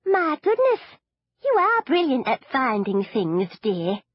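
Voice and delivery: chirpy grandmother praising her granddaughter; doting granny; old granny. Acted. Granny voiced in the story.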